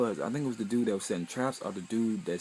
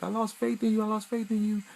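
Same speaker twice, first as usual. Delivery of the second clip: put-on high, loud, strained girl's wail; a high, loud mock cry of betrayal repeated twice; anguished cry; 13 semitones higher, 5 dB louder than usual. Tyra's anguished retold outcry.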